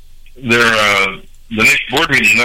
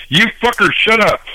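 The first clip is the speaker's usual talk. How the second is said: yelling; 10 semitones higher than usual. Real, not acted. Yelling at voices on the line.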